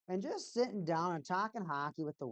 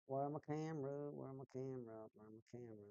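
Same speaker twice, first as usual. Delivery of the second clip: softer and lower with each repeat; fading repeated question; 4 semitones lower, 11 dB quieter than usual. Real, not acted. Streamer calling for the lost camera, fading away. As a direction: trail away, low and quiet.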